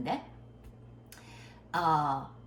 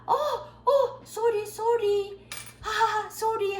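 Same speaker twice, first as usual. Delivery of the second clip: very high, giggly, stretched sorries; flustered grandfather apologizing; 4 dB louder, much slower than usual. Retold or imitated. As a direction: mimic an old man startled into an embarrassed apology. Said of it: "어떤 할아버지가 그 말 잘 못 알아듣고". (당황한 할아버지의 높고 웃음 섞인 사과 흉내)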